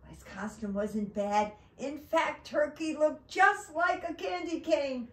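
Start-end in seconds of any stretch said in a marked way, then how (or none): 1.8-5.1 high, loud storybook enthusiasm; emphatic, high-pitched narration; excited build-up. A narrator's reading. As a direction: sell the disguise with delight. Building up disguise before letdown.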